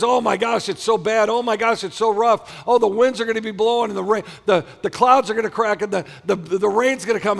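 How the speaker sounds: high, loud, worried rush; hammed-up fretting over disaster; mock panic. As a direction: pile up the woes in alarm. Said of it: "we look at the storms of our life as".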